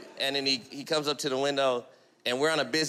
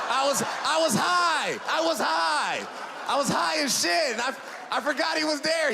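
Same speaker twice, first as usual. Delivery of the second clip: high, raised-voice comic confession; exclaimed excuse; 10 semitones higher than usual. Acted. Comedian explaining the illegal U-turn, high exclaimed excuse. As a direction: cry it out as a frantic excuse.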